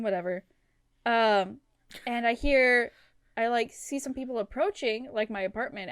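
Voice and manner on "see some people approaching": ordinary talk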